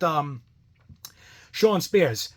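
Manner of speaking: ordinary talk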